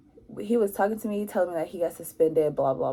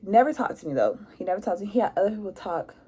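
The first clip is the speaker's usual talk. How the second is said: ordinary delivery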